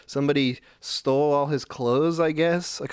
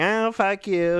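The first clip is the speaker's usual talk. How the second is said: higher, louder parting shot; retold angry retort; 9 semitones higher, 5 dB louder, slower than usual. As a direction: imitate a woman cursing on her way out. Acts out the woman walking out.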